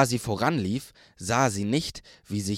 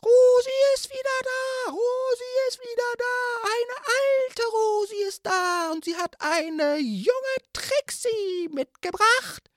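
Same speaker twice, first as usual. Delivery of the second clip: very high, squeaky shouting sprite; beeping tiny drone. A narrator's reading. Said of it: "seiner lauten und piepsigen Stimme".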